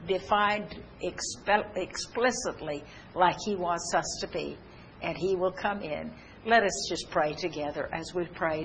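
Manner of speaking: ordinary talk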